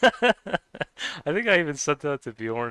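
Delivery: can't hold back a laugh while telling it. Real, not acted. Laughing at silly video.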